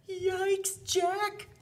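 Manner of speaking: high squeaky helper voice; puppet voice. Acted. Puppet sidekick reacting to the giant, high and squeaky. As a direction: chirp it like a little puppet friend.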